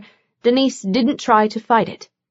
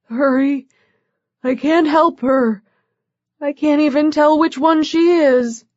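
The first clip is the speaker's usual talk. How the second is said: higher, weak, panicked plea; weak frantic voice; 5 semitones higher than usual. A narrator's reading. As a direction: plead weakly, scared and urgent. Weak, panicked man on phone.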